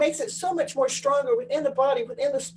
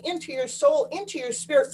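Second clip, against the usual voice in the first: usual speaking voice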